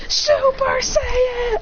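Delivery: high, drawn-out battle cry; power-up cry. Acted. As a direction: stretch it like an anime transformation. Acted anime power-up call.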